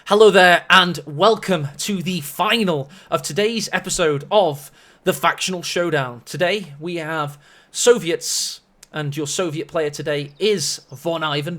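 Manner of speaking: loud, punchy show opening; match announcer. Acted. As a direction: welcome the viewers like a tournament host.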